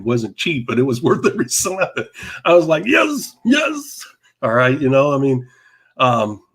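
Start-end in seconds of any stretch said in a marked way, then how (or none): 2.9-4.1 high, thrilled double yes; a much higher, gleeful yes, yes; excited yes. Retold or imitated. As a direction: squeal it out with delight. Retold delight after the show.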